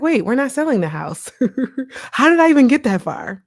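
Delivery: high, loud, amused disbelief with a laugh; laughing at herself. Retold or imitated. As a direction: laugh off your own runaway thoughts. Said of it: "I'm like".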